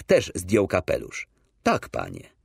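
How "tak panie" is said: put-on a low old man's voice, a touch of tremble; lightly voiced old teacher answering softly; low voice of an old schoolmaster, barely trembling; old teacher's voice. A narrator's reading. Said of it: "Głos jego drżał trochę, ale był dźwięczny".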